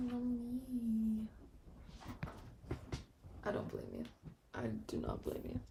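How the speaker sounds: normal talking voice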